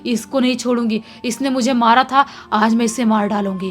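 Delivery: loud vengeful threat in a heavy voice; heavy-voiced angry yell. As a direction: roar it as the spirit inside her. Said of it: "भारी आवाज़ में बोलती है". (भारी आवाज़ में गुस्से से चिल्लाना)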